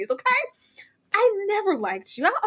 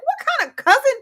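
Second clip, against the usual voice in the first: high incredulous; 9 semitones higher, 4 dB louder than usual. Retold or imitated. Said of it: "I was like".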